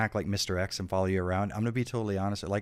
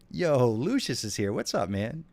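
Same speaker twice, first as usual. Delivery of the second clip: excited greetings; 5 semitones higher, 5 dB louder than usual. Real, not acted. Greeting chat viewers excitedly.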